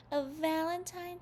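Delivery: high excited squeal. A narrator's reading. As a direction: squeal with delight. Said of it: "Sally squealed".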